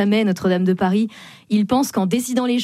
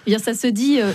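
normal talking voice

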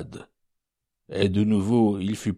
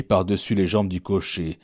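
ordinary delivery, nothing marked

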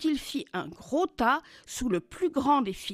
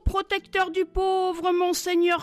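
high, louder mock-polite cry that eases off; calling out mockingly; 7 semitones higher, 4 dB louder than usual. A narrator's reading. Jackal escaping, calling back high mock thanks. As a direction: shout thanks while running off. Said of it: "se sauva en criant".

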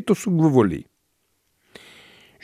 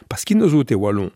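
usual speaking voice

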